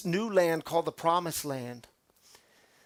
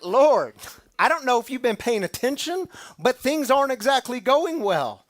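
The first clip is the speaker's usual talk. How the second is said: higher, louder, complaining plea; exasperated Joshua; 6 semitones higher, 5 dB louder than usual. Acted. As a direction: whine back at God, fed up. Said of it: "can you imagine Joshua's response".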